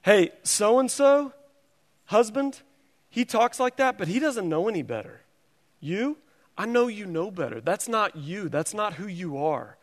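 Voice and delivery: sweet, tender reproach, voicing the wife; kindly and sweetly. Wife correcting her preacher husband about his talk, kindly and sweetly. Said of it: "when she kindly and sweetly".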